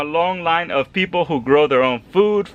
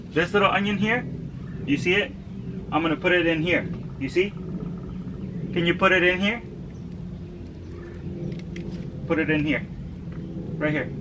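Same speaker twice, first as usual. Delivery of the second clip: gentle coaxing; 2 semitones higher, 7 dB quieter than usual. Real, not acted. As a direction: ease her into it gently, quietly. Dad coaxing toddler to plant.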